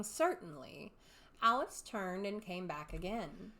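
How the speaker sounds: plain reading voice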